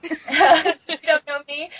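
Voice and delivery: laughing echo. Real, not acted. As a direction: repeat it while cracking up. Echoing friend's quote, laughing.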